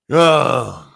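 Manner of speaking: loud groan; real groan of exasperation; disgusted groan. Real, not acted. Streamer reacting to a viewer's message, groaning.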